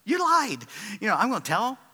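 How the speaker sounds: hammed-up shocked accusation; mock shocked accusation. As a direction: catch him out with a laughing gasp. Realising the rescue was a ruse.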